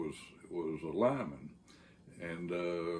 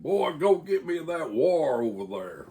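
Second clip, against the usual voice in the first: louder, higher barked order to a grunt; bossy yell; 8 semitones higher, 7 dB louder than usual. Retold or imitated. Lineman orders the Yankee grunt to fetch wire, bossy yell. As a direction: yell it down at the boy like a boss lineman.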